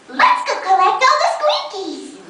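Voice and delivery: high, drawn-out princess cheer; game princess. Acted. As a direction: sing out slowly in a high character voice. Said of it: "and then she's like".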